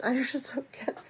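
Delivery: breathy whispered aside with a laugh. Real, not acted. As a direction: whisper it to yourself, amused.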